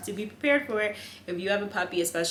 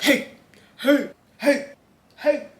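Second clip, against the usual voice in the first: louder, firm "hey" but no deeper; stern deep voice attempt; 9 semitones higher, 9 dB louder, slower than usual. Shown as a demonstration. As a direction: tries to sound stern to stop the dog. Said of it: "a really deep voice, but, you know, I try".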